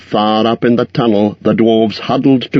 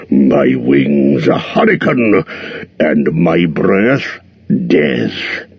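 very slow, grand dragon voice; proud dragon listing his weapons; boasting dragon; 2 semitones higher, slower than usual. A narrator's reading. Smaug boasts of his armor.

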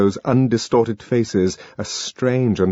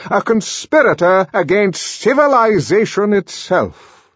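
raised, loud, official denunciation; a stern director addressing the crowd; pompous director denouncing; 11 semitones higher, 5 dB louder than usual. A narrator's reading. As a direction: condemn him before the whole room.

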